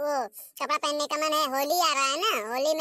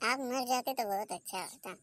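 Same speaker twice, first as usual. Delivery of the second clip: much softer, lower, under the breath; muttered aside; 3 semitones lower, 7 dB quieter than usual. Acted. Daughter-in-law cursing mother-in-law under her breath, muttered. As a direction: mutter it so she won't hear.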